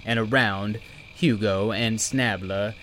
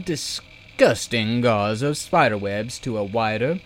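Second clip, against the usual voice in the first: ordinary delivery, nothing marked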